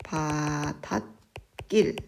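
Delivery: 바닷길 as 바다-낄, no ㄷ closing 다; alternative pronunciation. Shown as a demonstration. Teacher offering students the other allowed form, 바닷길 as 바다낄. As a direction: hold 바다, then a tense 낄 in 바닷길. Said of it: "혹은 바닷길이라고 해도 됩니다".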